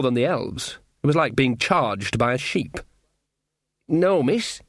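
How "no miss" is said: slightly raised, uneasy reply; frightened. A narrator's reading. Frightened servant answering the queen, nervously. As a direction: humour them, nervously. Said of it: "Sean suddenly felt frightened".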